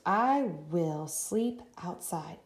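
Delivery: very slow, word by word; slow dictation. Shown as a demonstration. Teacher dictating sentence slowly to class. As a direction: stretch each word for writing. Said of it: "Let's write this together".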